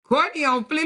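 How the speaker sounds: sassy mock hype. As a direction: hype her up with a smirk. Mocking Courtney's nails.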